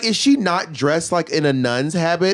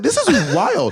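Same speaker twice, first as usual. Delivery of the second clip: mock amazed; 12 semitones higher, slower than usual. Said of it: "I was like".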